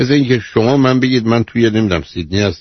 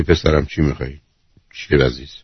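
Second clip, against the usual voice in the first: gentle, lower and softer; very kind and good; 7 semitones lower, 4 dB quieter than usual. Shown as a demonstration. Host demonstrating a tender reply to a son, lower and softer. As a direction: ask your son tenderly what he wants. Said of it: "خیلی مهربون و خوب".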